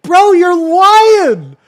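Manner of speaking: very high, very loud screech; hammed-up wailing protest. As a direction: scream it like an outraged crybaby. Hammed-up outburst at being contradicted.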